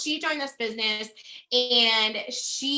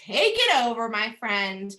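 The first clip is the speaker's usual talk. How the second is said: normal talking voice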